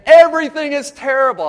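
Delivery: high, loud cry of everything is terrible; mock melodrama; dramatic complaint. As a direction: overblown despair on the phone to his wife. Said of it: "I call my wife and say".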